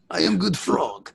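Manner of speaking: slow, clumsy fake Greek accent; bad Greek accent. Playing the Greek officer at leapfrog, mock Greek accent. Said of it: "shitty Greek accent stuff".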